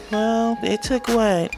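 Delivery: ordinary talk, nothing marked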